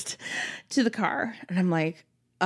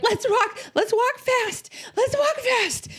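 panicked urging; 13 semitones higher than usual. Retold or imitated. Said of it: "So, so I'm like".

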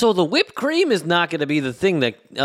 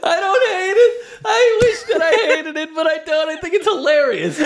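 high, laughing through the words; can barely talk for laughing; 13 semitones higher than usual. Real, not acted.

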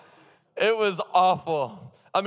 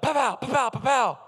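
mock gunshots; 6 semitones higher, 3 dB louder than usual. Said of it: "be like".

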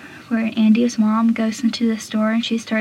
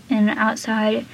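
ordinary talk

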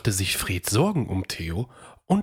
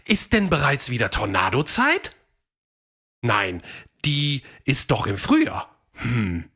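higher, lively dino voice debating with himself; Theo the dinosaur; 6 semitones higher than usual. A narrator's reading. Theo wonders what the dust cloud is, lively. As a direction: guess and answer your own questions.

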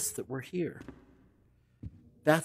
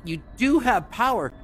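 much higher and louder, outraged; indignant disbelief; 7 semitones higher, 5 dB louder than usual. Real, not acted. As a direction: call them out, can't believe it. Discovers the room has power.

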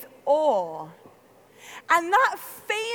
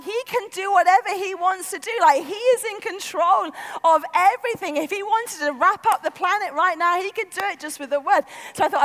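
normal talking voice

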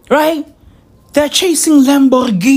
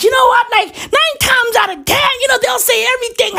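excited shout; 12 semitones higher, faster than usual. Real, not acted. Rant rising to excited shout.

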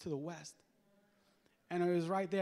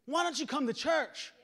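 high, insistent invitation; pressing plea, raised voice; compelling; 9 semitones higher, 6 dB louder than usual. Retold or imitated. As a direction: urge them hard, pitched way up. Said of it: "Now say this, say compel".

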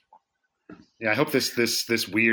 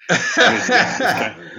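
breaking into laughter mid-sentence; laughing; 10 semitones higher, 8 dB louder, much slower than usual. Real, not acted. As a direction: laugh through the words. Laughing about enemy territory.

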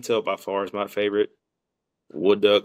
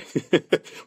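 high burst of laughter; 8 semitones higher than usual. Real, not acted. Laughs at buddy's line.

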